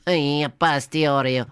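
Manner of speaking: put-on stretched pasta-chef Italian accent; mock Italian accent. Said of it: "in an Italian accent".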